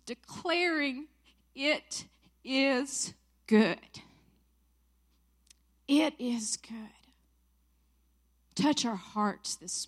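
real, emotional prayer with emphatic pauses; tearful prayer. Real, not acted. Woman opening a session before the Father, tearful prayer. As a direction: pray through tears, drawing out the key words. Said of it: "excuse the tears".

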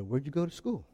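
low, soft doubting question; a churchgoer doubting the preacher, low and soft; skeptical questioner. Retold or imitated. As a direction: ask it with quiet suspicion. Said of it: "And they go".

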